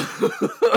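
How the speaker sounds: squealing laugh; nervous shriek of laughter; high-pitched laugh. Real, not acted. High shriek laugh after story.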